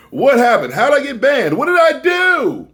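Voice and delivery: high, loud mock-dramatic questions. Comic building up his banning story, hammed-up high and loud. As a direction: ham up the suspense.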